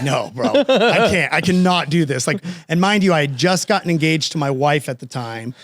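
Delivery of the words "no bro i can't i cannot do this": slow, laughing through the words; half-laughing, too scared to go; laughing, backing out of going to the lottery; laughing nervous refusal. Retold or imitated.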